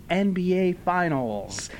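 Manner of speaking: normal talking voice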